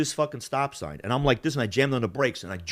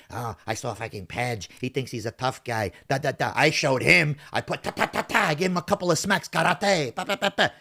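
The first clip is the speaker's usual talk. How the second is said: mocking boastful rival. Rival bragging to an old lady about smacks, mock boasting. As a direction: mimic his boasts, building to silly slap noises. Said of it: "blows up some old lady's fucking ears".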